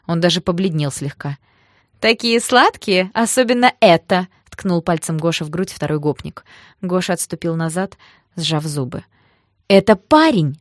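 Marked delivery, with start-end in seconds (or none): 2.0-4.3 loud, higher leer, drawn out at first; jeering. Acted. Second thug pokes Гоша, leering loudly. 9.7-10.6 indignant correction. Acted. Narrator corrects the thug.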